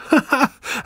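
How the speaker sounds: high hysterical cackle; hysterical laugh. A narrator's reading. Wife laughing at husband's offer, hysterical. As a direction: burst into a high, mocking laugh. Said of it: "Sie lachte hysterisch auf".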